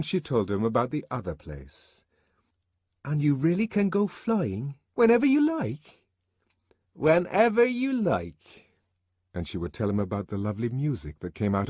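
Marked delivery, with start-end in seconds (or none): 3.0-6.0 put-on high child's question, rising in wonder. A narrator's reading. Boy questions mother about other place. 6.9-8.7 high, slow, drawn-out answer; mother's assurance. A narrator's reading. Mother answers the boy's question.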